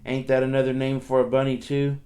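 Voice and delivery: usual speaking voice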